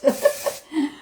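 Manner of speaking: short laugh at the struggle; a quick rueful chuckle; amused laugh. Real, not acted. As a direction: laugh at the mishap. Laughs at curls falling out.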